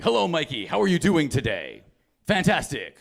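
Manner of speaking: over-enunciated pitchman greeting; mock salesman pep with swooping tune; infomercial dad. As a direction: imitate an immigrant dad talking like a TV ad. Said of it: "my dad talks like this now".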